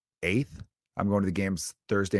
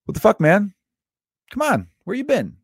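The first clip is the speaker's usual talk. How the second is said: high, loud mock exasperation; friendly scolding; 12 semitones higher, 6 dB louder than usual. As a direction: imitate a friend giving a ribbing reminder. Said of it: "saying it the way she did".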